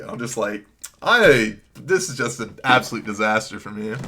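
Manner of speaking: usual speaking voice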